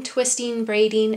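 plain reading voice

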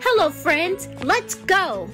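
usual speaking voice

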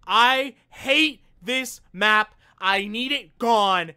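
high, loud, slow disgust; fed-up streamer ranting at the game; exasperated rant. Real, not acted. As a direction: groan it out, fed up with the map. Ranting about the hated map.

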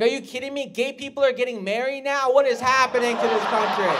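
high, loud outraged rant; exaggerated furious outburst; mock Republican rage. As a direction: ham up an indignant bigot. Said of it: "I went full Republican rage".

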